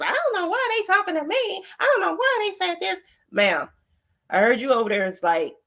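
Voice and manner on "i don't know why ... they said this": high mocking imitation of her; higher, whiny mock of the panel lady; mocking quote. Mocking the lady who said she didn't know them. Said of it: "It's just".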